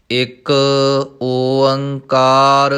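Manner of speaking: drawn out, stretched syllables; slow recitation. Shown as a demonstration. Teacher reciting Gurbani for students, slow and measured. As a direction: chant the word slowly and evenly.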